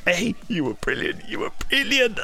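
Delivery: breathy-free light gushing, voice rising; mock starstruck praise; quoting gushing actress. Actress praising the wrong actor, lightly gushing. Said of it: "and she was going".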